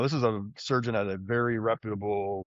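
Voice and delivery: ordinary delivery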